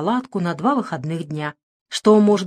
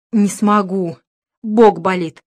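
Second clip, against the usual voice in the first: стон от боли; 5 dB louder, slower than usual. Acted. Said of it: "простонал".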